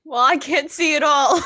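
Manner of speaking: high, shouted comic exasperation; loud shouted reply. Acted. Blinded character answering a deafened friend, shouted. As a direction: yell back at someone who can't hear.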